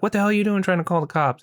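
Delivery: angry demand. Retold or imitated. Alice catching Ben calling the cops, fast mock anger. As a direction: snap it out quickly at him. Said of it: "And she's like".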